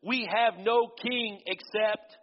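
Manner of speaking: high, slow, drawn-out declaration; high priest's declaration. Acted. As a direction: lay it out deliberately. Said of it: "the high priest answered".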